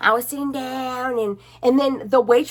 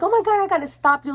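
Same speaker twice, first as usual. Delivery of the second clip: high, fast excited gush; high fast mock gush from an excited woman; 9 semitones higher, faster than usual. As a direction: rush it out thrilled. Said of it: "she's like".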